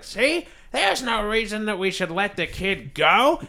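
high, slow, squawky mock insistence; hammed-up put-on voice. Orphanage staff seize on the kid's lie, high put-on voice. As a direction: raise the pitch and draw it out. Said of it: "immediately they're like".

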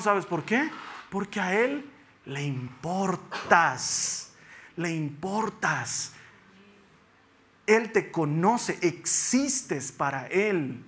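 slow, drawn-out pastoral plea; slow preaching appeal. Acted. Preacher assures congregation God cares, slow and pleading. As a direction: stretch each word to comfort the listener.

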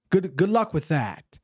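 normal talking voice

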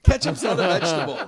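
laughing loud protest. Real, not acted. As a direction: burst out laughing.

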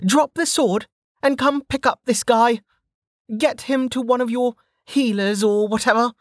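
put-on high-pitched brash young woman; Velika's voice. A narrator's reading. Velika scolding the downed sentinel, high and cocky. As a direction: toss it off, cocky and unbothered.

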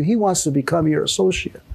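normal talking voice

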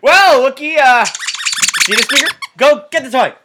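high, loud pet-talk voice. Pretend. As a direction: coax the dog upward and loud. Talking to dog as Wookiee.

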